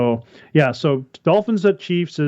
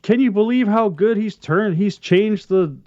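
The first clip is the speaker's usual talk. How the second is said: higher-pitched mock amazement; gushing commentator; 6 semitones higher than usual. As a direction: imitate an awestruck announcer gushing. Mimics gushing broadcast commentators.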